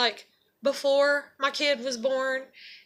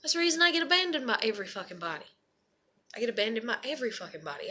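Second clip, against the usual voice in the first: softer, quicker, dropping away; subdued repeat; 5 semitones lower, 7 dB quieter, much faster than usual. Real, not acted. Vlogger repeating why she gets abandoned, subdued. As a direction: say it low to yourself, rushed and deflated.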